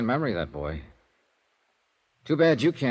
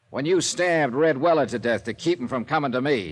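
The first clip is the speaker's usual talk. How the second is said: hard accusation; 6 semitones higher, 4 dB louder than usual. Acted. Detective accuses suspect of murder.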